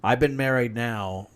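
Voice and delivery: ordinary delivery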